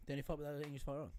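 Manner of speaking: "The only fight without an English fighter on" rattled off quickly as a tongue twister; said fast. Shown as a demonstration. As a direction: blurt it all out in one fast breath. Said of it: "Say it fast".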